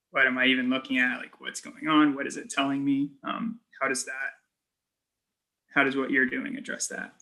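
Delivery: usual speaking voice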